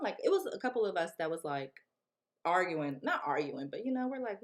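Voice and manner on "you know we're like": normal talking voice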